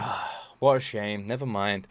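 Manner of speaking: slow, low, soft pretend disappointment; hypothetical onlooker shrugging it off, quietly; mock offhand regret. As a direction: sigh it out as if you barely care. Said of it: "And I was like".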